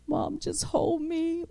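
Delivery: put-on a sick kid begging to be held; whiny little child. Sick child asking for mom.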